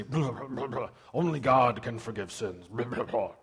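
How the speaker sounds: low, garbled mock grumbling with pompous words between; grumbling Pharisees. Preacher imitating the Pharisees objecting to Jesus, mock grumbling. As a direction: imitate disapproving religious leaders mumbling. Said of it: "that's exactly how they sounded".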